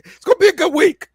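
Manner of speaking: loud, high, quick proclamation; shouted prediction. Real, not acted. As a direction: shout it out like a called shot. Repeats prediction as a called shot.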